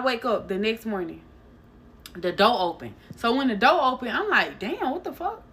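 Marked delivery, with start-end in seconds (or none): 4.6-5.4 high, soft, breathless shock; jolted awake and spooked; a sudden, alarmed gasp of a curse; startled what the fuck. Retold or imitated. Waking to find door open.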